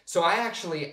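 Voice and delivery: ordinary talk, nothing marked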